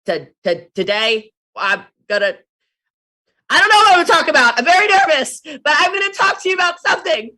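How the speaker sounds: mock nervous stammer, high and loud; nervous beginner. Imitating her nervous first live video, high and loud. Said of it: "That was me on live video".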